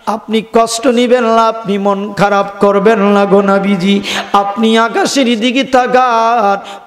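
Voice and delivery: ordinary talk, nothing marked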